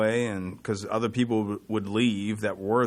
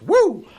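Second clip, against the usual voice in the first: high loud wrestler woo; Ric Flair woo; 10 dB louder than usual. Retold or imitated.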